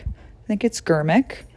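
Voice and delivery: ordinary talk, nothing marked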